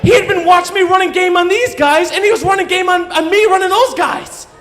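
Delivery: high, loud disbelieving yell; comic full-blown rant at being conned; outraged shouting. Acted. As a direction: shout it at the top of your voice, incredulous. Outburst at realising the con.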